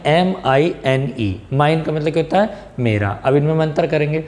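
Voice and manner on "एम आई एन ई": "एम आई एन ई" spelled letter by letter, very slow; spelling out letters. Shown as a demonstration. Teacher spelling "एम आई एन ई" for students, letter by letter. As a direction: spell "एम आई एन ई" one letter at a time. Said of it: "माइन, यानी कि माइन".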